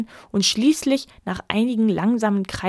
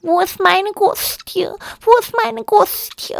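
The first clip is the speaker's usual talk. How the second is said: put-on high, slow child's whimper; wailing child; 14 semitones higher, 4 dB louder, slower than usual. A narrator's reading. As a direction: cry for the lost goose like a little boy. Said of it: "auch Peterle jammerte". (jammerndes Kind)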